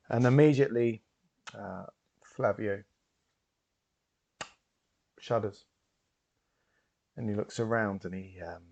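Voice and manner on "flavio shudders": usual speaking voice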